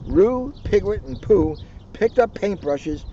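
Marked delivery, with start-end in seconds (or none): none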